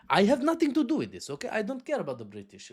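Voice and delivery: high-pitched start in a put-on Russian accent; mock Russian accent. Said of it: "And Russia will say".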